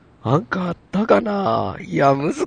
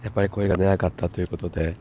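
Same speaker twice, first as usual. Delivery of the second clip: hoarse, stuffy voice from a cold; nasal cold voice; 9 semitones lower, 6 dB quieter than usual. Real, not acted. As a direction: talk through a blocked nose. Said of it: "すごい鼻声".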